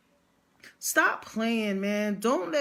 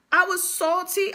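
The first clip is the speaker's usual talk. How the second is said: ordinary delivery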